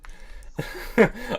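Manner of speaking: high, breathy chuckle; amused laugh. Real, not acted. Streamer on this being his last stream, high breathy laugh. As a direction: break into a short high laugh.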